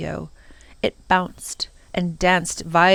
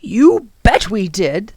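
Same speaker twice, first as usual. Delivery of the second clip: enthusiastic; 2 semitones higher, 7 dB louder, slower than usual. Acted. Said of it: "the professor replied enthusiastically".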